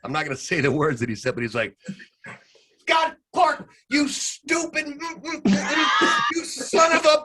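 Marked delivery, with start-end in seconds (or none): 2.9-7.2 high, loud mock shout at a colleague; hammed-up furious announcer outburst; imitated tirade yell. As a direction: scream it in a fake rage. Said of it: "And he literally went".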